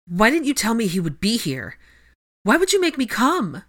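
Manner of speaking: high, anguished reproach; upset wail at her sister, no full sobs; near sobbing. A narrator's reading. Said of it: "Parvati completely dissolved into sobs".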